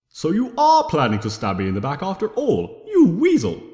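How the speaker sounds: rising to a loud yell on weasel; furious outburst. Acted. Discord yells at Tirek for planning the betrayal. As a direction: explode at the traitor.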